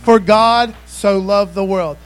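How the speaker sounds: loud and slow, every word weighted; slow booming pulpit reading; slow preached scripture. Acted. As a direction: lay it out slowly for the whole room.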